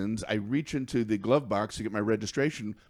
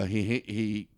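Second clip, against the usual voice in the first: normal talking voice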